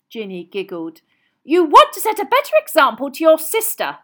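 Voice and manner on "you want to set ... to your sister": loud, high scolding snap; cross mother telling off her sons; sharp, forceful rebuke; snapped. A narrator's reading. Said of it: "snapped Missus Weasley".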